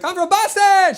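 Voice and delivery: high, overexcited yell; mock excited. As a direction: imitate a tourist bursting with hometown pride. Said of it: "She's all excited".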